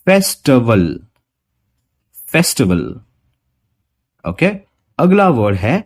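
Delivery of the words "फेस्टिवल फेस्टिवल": फेस्टिवल with a weak schwa in the middle; correct schwa. Shown as a demonstration. Teacher modelling the corrected pronunciation. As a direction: say फेस्टिवल with the i reduced to a. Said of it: "वहां पे अ लगाना है".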